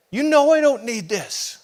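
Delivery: man yelling at God, hurt and angry. Retold or imitated. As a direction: shout your case up to God. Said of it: "This is what I would tell him".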